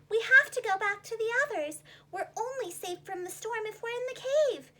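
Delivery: lightly voiced worried little fish; little striped fish. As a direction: plead to get to safety.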